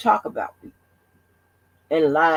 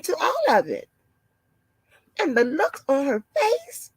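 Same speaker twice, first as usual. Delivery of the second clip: mock-alarmed office lady, pitched way up; 10 semitones higher than usual.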